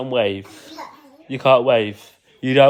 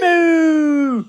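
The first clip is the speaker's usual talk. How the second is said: long, loud, high moo; cow moo; 11 semitones higher, 5 dB louder than usual. Pretend. Dad mooing along with his child, long playful moo. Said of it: "What about a cow?".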